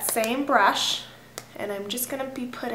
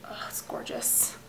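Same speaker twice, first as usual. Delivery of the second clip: whispered aside; 10 dB quieter than usual. Real, not acted. Whispered aside admiring highlighter.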